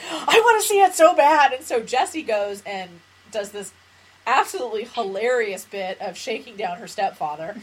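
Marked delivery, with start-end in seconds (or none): 0.1-1.5 eager pleading. Retold or imitated. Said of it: "She goes".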